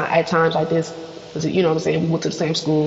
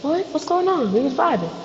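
confused cousins; 10 semitones higher than usual. Retold or imitated. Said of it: "Everybody looking like".

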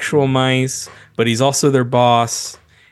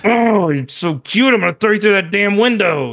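imitating a dad teasing his little kid; mock-threatening loving dad; 9 semitones higher than usual. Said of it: "he'd be like".

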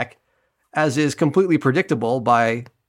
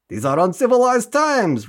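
voicing Rifa; 9 semitones higher than usual. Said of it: "Rifa responds".

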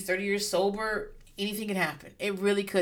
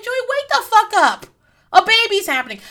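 high, loud mock shout to wake him; yelling at Joey; 11 dB louder than usual. Telling sleeping Joey to wake up, loud exasperated shout.

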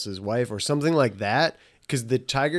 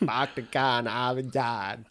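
put-on Boston accent; 2 semitones lower, 7 dB quieter than usual. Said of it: "that's not a good Boston accent".

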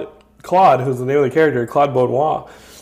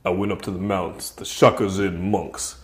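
lower, softer washed-up fighter voice; a low, hushed, self-serious fighter; Razorblade; 8 semitones lower, 9 dB quieter than usual. Acted. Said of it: "He's like".

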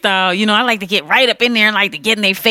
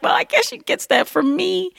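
proud mom; 11 semitones higher, 4 dB quieter than usual. Retold or imitated. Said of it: "she was like".

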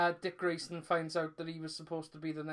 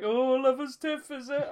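high, loud teasing mockery; jeering; 7 semitones higher, 8 dB louder than usual. Comics guests jeering [name], high mock teasing. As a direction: imitate guests ribbing him, pitch lifted high. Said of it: "they all jeered me".

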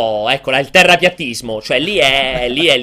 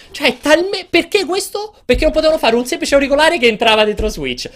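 exasperated; 8 semitones higher than usual. Real, not acted. Ranting about awkward headset setup.

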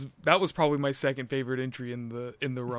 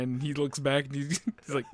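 ordinary talk, nothing marked

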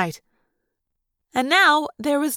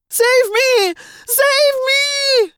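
very high, loud scream for help; shrill horrified scream; 13 semitones higher, 5 dB louder, much slower than usual. A narrator's reading. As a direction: scream in horror, stretching the last cry. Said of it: "the shrill, horrified scream of Jeremy Jason".

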